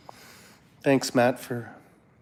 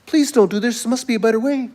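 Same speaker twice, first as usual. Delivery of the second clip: high, louder pleading as friends; begging and pleading; 10 semitones higher, 4 dB louder than usual. Retold or imitated. Friends in his dream beg him not to face the bear, begging. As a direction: plead urgently, voice pitched way up. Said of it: "they're begging and pleading with me".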